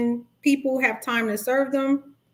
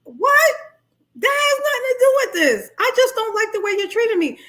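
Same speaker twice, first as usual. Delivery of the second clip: baffled disbelief; 11 semitones higher than usual. Retold or imitated. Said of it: "And you sitting there like".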